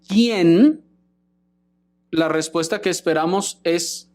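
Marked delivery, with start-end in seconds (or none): 0.1-0.8 ¿quién? said louder and higher with a rise; stressed question word. Shown as a demonstration. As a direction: hit ¿quién? hard as a rising question. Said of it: "Si preguntamos".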